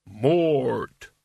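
sharp, vicious single word; vicious snap. A narrator's reading. Albert rebukes Mort for threatening him, vicious. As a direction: snap it out like a whiplash. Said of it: "snapped out as fast as a whiplash and twice as vicious".

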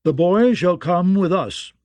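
loud, high and slow, every word insisted on; puffed-up lordly command; blustering demand. Acted. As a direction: lay down the law with bluster. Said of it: "Lord Belmore blustered".

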